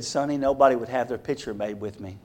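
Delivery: a hint of a crying boy; sad child. Dejected Tom telling Sonny nobody would pose with him, soft and sad. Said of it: "Tears are coming down his eyes".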